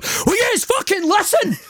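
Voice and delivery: very high-pitched mock frenzy; hammed-up frantic cry; mock losing it. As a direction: impersonate a man losing his mind, voice shooting up. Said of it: "Pat Nevin's losing his mind".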